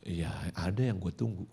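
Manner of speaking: low, soft dodging answer; calm reply. Retold or imitated. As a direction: answer the shouting quietly and low, acted. Calm son answers snappy father.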